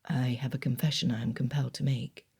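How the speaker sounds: Darcy confiding to Elizabeth mid-dance; whispered confession. A narrator's reading. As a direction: confide it close to her ear. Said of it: "he whispered".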